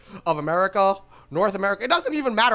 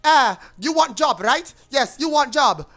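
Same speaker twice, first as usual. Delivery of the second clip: mock thick Indian accent, pitched higher; higher-voiced scam recruiter with an Indian accent; 4 semitones higher than usual. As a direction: imitate a pushy caller with a heavy Indian accent. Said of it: "thick ass Indian accent who's going".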